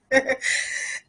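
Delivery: soft, breathless laugh half turning to a cry; a quiet, teary laugh-sob; tearful laugh. Real, not acted. Moved by the memory of the funeral: soft real teary laugh. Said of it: "I'm kinda tearing up about it".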